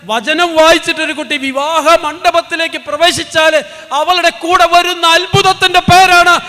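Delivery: loud, high, fervent preaching; preaching at full force. Acted. Preacher rousing the congregation about his daughter, loud fervent preaching. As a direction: preach it out to the hall with fire.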